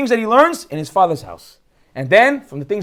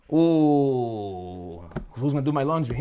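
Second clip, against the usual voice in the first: mock lament; 7 semitones lower, 6 dB quieter, much slower than usual. Mock lament at leaving mom.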